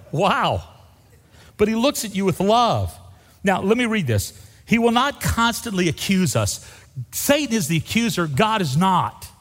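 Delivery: high, loud preacher's proclamation; ringing, high-pitched sermon delivery; impassioned preaching. Real, not acted. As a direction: preach it with full force to the congregation. Preaches at full force.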